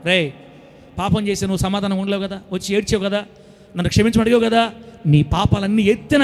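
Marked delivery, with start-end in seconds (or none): none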